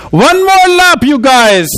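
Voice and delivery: put-on high-pitched German-accented coach yell. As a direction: call it out like a German soccer coach. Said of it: "he spoke with this German accent".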